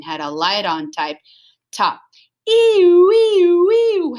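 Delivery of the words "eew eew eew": very high, loud wailing siren; police car siren chasing the dogs; police siren howl. Acted. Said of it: "howled the police car".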